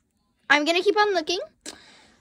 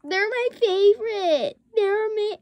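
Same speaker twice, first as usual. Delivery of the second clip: excited gushing; 9 semitones higher, 4 dB louder than usual. Real, not acted. Gushes over a favorite.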